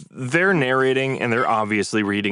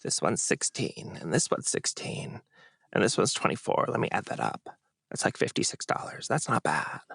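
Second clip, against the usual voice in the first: vocal fry; 7 dB quieter than usual. Retold or imitated. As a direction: drag each number in lazy fry. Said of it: "she's like".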